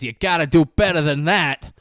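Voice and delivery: loud, put-on bossy order; mock demand. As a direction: order them loudly to try harder. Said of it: "And he goes".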